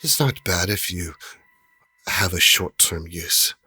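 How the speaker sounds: lightly voiced merchant, slow and measured; a hint of a hushed, knowing dealer; genie trader. Game master as trader explaining binding to adventurers, slow and measured.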